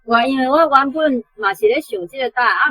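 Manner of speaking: ordinary talk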